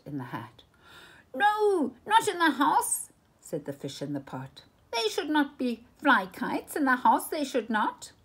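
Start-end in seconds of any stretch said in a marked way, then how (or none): 1.3-3.1 high, loud alarmed protest; fish shouting no. Acted. Fish in the pot cries out against the kites. 4.9-8.1 high, raised fretful voice; anxious fish scolding. Acted. Fish in the pot frets at the cat, high and anxious.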